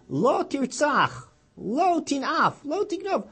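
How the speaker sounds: slow, high, intoned commandments; solemn recitation. Acted. Preacher intoning the commandments slowly to the congregation.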